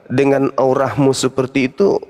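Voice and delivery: ordinary talk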